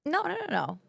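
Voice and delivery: high, eager "no, no, no, no"; mom insisting. Retold or imitated. Dancer quotes mom urging her back: high insistent. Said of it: "my mom kind of was like".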